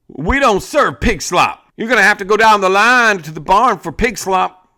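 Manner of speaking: high, loud jittery barkeep; a scared bartender missing the sarcasm; nervous bartender. Acted. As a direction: answer fast out of fear. Frightened bartender answers.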